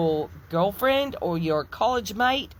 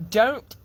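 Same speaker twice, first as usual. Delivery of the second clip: don't punched higher and louder; stressed don't; 5 semitones higher, 5 dB louder than usual. Shown as a demonstration. Pleading with churchgoers, don't hit high and loud. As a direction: lean hard on the single word don't. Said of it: "then please".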